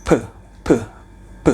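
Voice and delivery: "P" said as a bare breathy puff, three times; phonetic P drilled. Shown as a demonstration. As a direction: repeat "P" with a strong burst of air. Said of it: "tapi dibaca".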